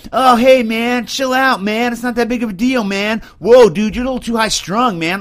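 sneering impression of a dude who says take it easy; laid-back slacker. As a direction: ham up a chill bro telling him to relax. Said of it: "everybody wants you just like".

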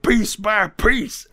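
slow, high, hammed-up furious threat; mock rage. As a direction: spit out each word in mock fury. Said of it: "he gets really mad there".

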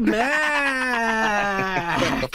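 gleeful teasing shout at his cohost; teasing yell. Real, not acted. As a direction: call him out, hamming it up.

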